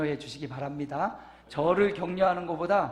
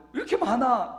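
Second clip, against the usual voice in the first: a higher, louder mock grumble; complaining; 9 semitones higher, 8 dB louder than usual. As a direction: grumble as if complaining about dinner. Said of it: "불평하듯 말을 했잖아요". (불평하듯 높고 크게 투덜대기)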